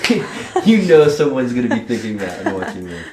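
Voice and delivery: loud, high laughing delivery; cracking up. Real, not acted. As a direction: burst out laughing and talk through it. Cracking up at own joke.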